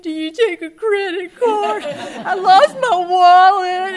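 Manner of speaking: high, loud, slow laughing wail; laugh-cry through the question; loud flustered laugh-cry at the kiosk; teary laughing plea. Retold or imitated.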